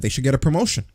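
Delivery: usual speaking voice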